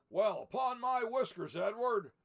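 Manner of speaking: loud, high exclamation; nearly knocked over, cry out; startled Santa. Acted. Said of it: "said Santa".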